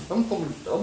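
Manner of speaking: badly faked Irish accent; half-hearted Irish accent impression; Liam Neeson. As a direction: attempt a soft Dublin accent and miss. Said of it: "talk in his Liam Neeson accent".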